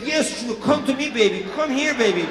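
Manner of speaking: coaxing lover. Retold or imitated. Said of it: "I was like".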